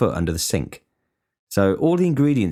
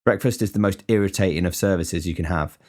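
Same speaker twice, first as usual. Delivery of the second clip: usual speaking voice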